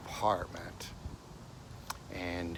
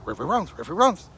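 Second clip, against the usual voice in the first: talking dog; 12 dB louder than usual. Retold or imitated. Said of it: "And he goes".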